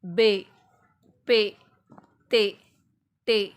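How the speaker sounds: each letter called out loud and clear; teacher calling letters one by one; loud letter names. Shown as a demonstration. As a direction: call out every letter to the child. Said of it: "जैसे कि आप देख रहे हैं".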